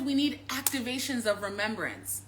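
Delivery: ordinary delivery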